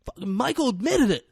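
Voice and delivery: high-pitched imitation of a crying wreck; mock crying. Wrecked man insists the other confessed, mock crying. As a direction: protest like a man falling apart. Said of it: "he's crying. He's a wreck".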